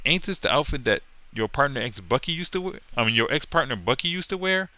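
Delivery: lightly voiced bragging punk; taunting sidekick. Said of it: "This punk is saying".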